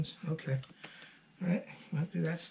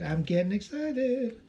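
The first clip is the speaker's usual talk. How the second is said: excited; 5 semitones higher than usual. Real, not acted.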